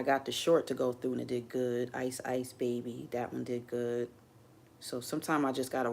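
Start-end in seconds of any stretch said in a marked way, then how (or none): none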